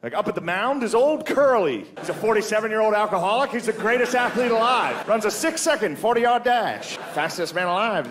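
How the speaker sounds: high, nasal-free vintage baseball call; old baseball radio call; old-time radio announcer. Retold or imitated. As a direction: crow it like a 1940s radio booth. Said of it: "You can hear the announcers".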